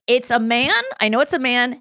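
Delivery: normal talking voice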